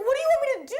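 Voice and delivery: high, loud desperate cry at the vet staff; panicked yell. Retold or imitated. Retelling the vet panic, a high loud desperate yell. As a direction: throw your hands up and yell it high and loud.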